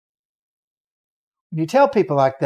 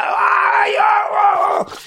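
high-pitched retold demon screams; loud, very high shrieking ahs; 4 dB louder, much slower than usual. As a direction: shriek like a demon being driven out. Demon shaking man in lake.

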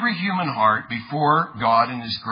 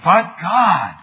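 "But God" hit loud and higher, imitating a preacher; emphatic BUT GOD; 5 semitones higher, 6 dB louder than usual. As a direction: punch out both words of "But God". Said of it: "he really was emphasizing".

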